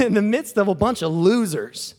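normal talking voice

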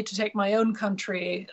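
usual speaking voice

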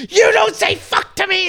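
very high, loud outraged shout; indignant shriek. Retold or imitated. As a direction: play the offended neighbour screaming back. Neighbour Nancy yelling back.

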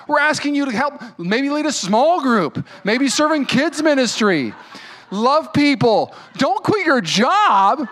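high, loud, insistent no, no; fired-up loud plea, stretching the final words; urgent pleading. Real, not acted. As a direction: beg them not to quit, slowing down to hammer it home. Pleading not to quit jobs.

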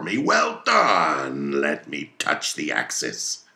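put-on a fierce old sea captain rallying his crew; commanding, hammy captain with bursts of loud toasts; Captain Ahab voiced. Ahab to his mates and harpooneers, commanding and fierce.

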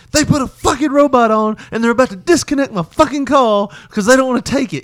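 high, loud exasperated yelling; angry rant. Retold or imitated. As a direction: reenact an outraged tirade about the robot. Reenacting rage at the robot call.

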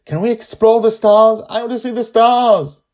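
put-on a girl calling out her delight; excited child Joy. Joy points down at the ocean, crying out high and loud.

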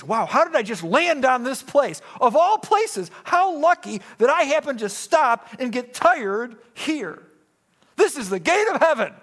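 high, loud amazed exclamation; Jacob, marvelling at his lucky discovery; awed Jacob. Retold or imitated. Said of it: "Jacob thinks".

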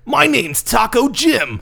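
hammed-up announcer. Hammed-up character intro.

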